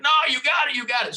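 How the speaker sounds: high, loud encouragement; urging shout. Retold or imitated. Jaime shouting at the narrator to keep going. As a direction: cheer him on to keep reeling.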